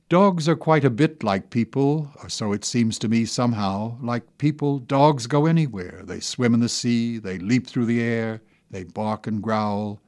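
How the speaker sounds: ordinary talk, nothing marked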